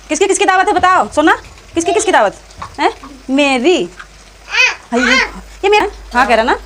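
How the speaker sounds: high, loud sing-song teasing. Real, not acted. Aunt teasing her little nephew about the party, high playful baby talk.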